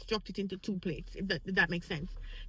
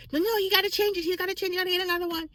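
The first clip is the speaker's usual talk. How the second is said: high insistent urging; 8 semitones higher, much faster than usual. Retold or imitated. Urging waiter to replace dessert.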